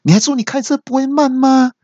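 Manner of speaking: very high mock shout of disbelief; angry yelling. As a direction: squeal it like an exasperated stranger. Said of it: "就气到大叫说".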